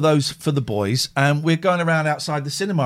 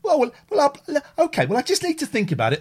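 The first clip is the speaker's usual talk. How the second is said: flustered stalling; 11 semitones higher, faster than usual. Retold or imitated. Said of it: "And you go".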